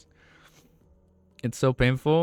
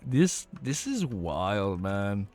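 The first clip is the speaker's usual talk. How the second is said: low, very slow, worn-out voice; tired voice running out; losing voice; 3 semitones lower, slower than usual. Real, not acted. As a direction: drag the words out as your voice gives up. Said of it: "I'm losing my voice".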